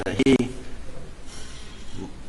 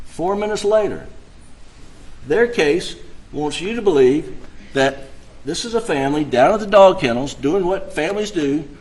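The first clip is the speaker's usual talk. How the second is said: rising, louder, heated appeal to jury; passionate closing argument; 8 semitones higher, 4 dB louder than usual. Acted. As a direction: build the outrage toward the jury.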